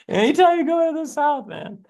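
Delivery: high-pitched, breaking out of laughter; tickled, voice jumping high; laughing. Real, not acted. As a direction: squeal it through real laughter. Laughing after his bayou bit.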